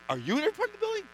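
high bright echo of the question; a high mock-girlish echo; mimicking her reply. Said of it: "She goes".

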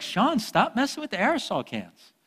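high mock-scolding mother; imitating mom scolding her son. Mom scolding child in story.

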